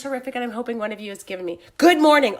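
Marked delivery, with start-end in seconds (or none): 1.8-2.4 loud reading of a shouted greeting; shouting. Retold or imitated. Reading a viewer's shouted comment aloud, loud shouted greeting. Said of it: "she's shouting".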